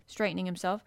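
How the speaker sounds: ordinary delivery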